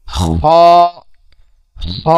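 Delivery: long scraped kh on হ, then an open "o"; back-of-throat kho. Shown as a demonstration. Teacher demonstrates Arabic kho, written হ, scraped from the throat. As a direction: rasp হ from the end of the throat. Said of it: "খটা উচ্চারণ হবে কণ্ঠনালীর".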